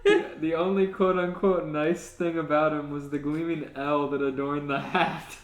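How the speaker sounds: fighting off laughter mid-sentence; laughing. Real, not acted. Giggling while reading description.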